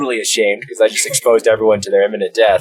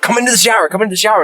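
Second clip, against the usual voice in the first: high, louder eager call to friends; excited calling out; 3 dB louder, much faster than usual. Retold or imitated. Calling flatmates into the shower, high and excited. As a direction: call them in, thrilled.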